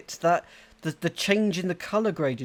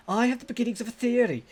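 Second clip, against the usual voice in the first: higher-pitched, amateur put-on Scottish accent; Scottish-leaning accent; 6 semitones higher than usual. Player voices a customer with a theory, attempted Scottish accent. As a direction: voice a character with a wandering Scottish lilt. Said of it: "that was more Scottish".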